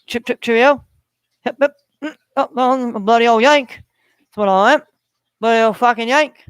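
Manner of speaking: put-on English accent. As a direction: play up a cheeky mock-British voice. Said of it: "English accent".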